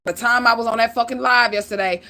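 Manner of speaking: normal talking voice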